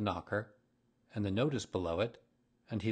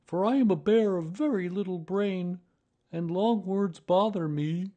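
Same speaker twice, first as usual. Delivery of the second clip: high, loud bear of little brain; simple-minded Pooh Bear, bothered; 10 semitones higher, 7 dB louder than usual. Acted. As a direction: confess it in a high-pitched bear voice.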